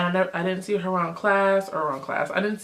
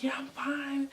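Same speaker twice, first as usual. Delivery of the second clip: a soft, higher breathless brush-off; out of breath; 6 semitones higher, 10 dB quieter than usual. Retold or imitated. Friend brushing off concern, softly breathless. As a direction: breathe it out lightly. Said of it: "she breathing out heavy".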